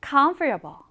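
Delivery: "comfortable" with COM strong, the tail falling; stress on the first syllable. Shown as a demonstration. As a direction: put the weight up front in "comfortable". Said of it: "강세는 앞에 넣어주시면 돼요".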